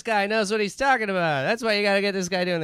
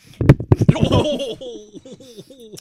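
high cackling laugh; 10 dB louder than usual. Acted.

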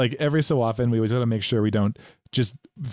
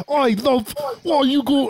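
much higher put-on chav British accent; mock chav accent; 12 semitones higher than usual. Imagining herself British, high mock chav voice. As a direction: squeal it like a cartoon British teen. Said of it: "if I was British, I'd be like".

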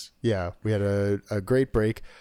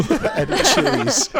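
high laughing At Chili's; 7 semitones higher, slower than usual. Real, not acted. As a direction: laugh through it, slow and high.

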